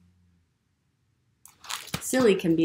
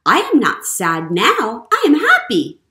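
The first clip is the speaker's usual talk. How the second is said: building to a much higher, louder happy cry; joyful outburst; 6 semitones higher, 12 dB louder than usual. Acted. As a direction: burst out with joy. Elephant turns happy.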